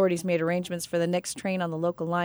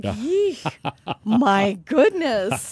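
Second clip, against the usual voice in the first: shocked; 3 dB louder, much slower than usual. Retold or imitated. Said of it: "I was like".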